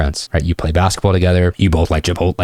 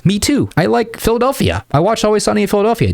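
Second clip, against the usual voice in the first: much higher, overeager me-too voice; mocking needy guy; 13 semitones higher than usual. Shown as a demonstration. Coach mocks the wrong reply to students, overeager and high. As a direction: ham up the try-hard fan. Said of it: "Don't say that shit".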